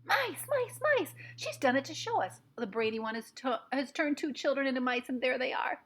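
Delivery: very high shrieks of alarm; shrieking witches. A narrator's reading. Witches spotting the mice, shrieking high. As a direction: squeal it like a panicked crowd. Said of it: "crying out".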